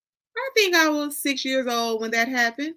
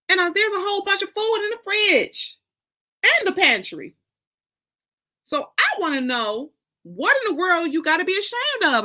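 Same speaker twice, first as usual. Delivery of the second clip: higher and louder, building outrage; mother outraged, louder and higher; indignant mother; 9 semitones higher, 5 dB louder than usual. Acted. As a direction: rise up indignant, voice climbing. Honey indignant at her daughter.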